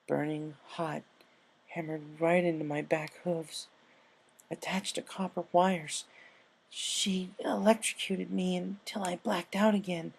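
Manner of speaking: shaky, halting, hushed retelling; tearful recounting. A narrator's reading. Rainbow tearfully telling Applejack what Pinkie did. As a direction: force out each painful memory.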